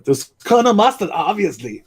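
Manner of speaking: high mock tough-guy voice; action hero voice. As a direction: ham it up like a muscle-bound action star. Imitating action heroes arguing Clue.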